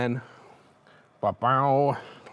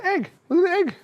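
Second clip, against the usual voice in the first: high, louder squeal over the egg; excited reveal; 3 dB louder than usual. Real, not acted. Host showing off the fried egg, excited high squeal.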